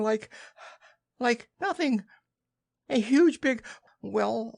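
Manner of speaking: high, halting piglet squeak; squeaky, flustered stammering; breathless Piglet. Acted. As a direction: grope for words between frightened puffs. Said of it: "breathing so hard that he could hardly speak".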